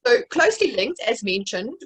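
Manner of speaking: ordinary delivery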